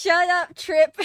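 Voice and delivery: loud playful shut up; loud laughing protest; laughing shout. Real, not acted. Laughing at chatter.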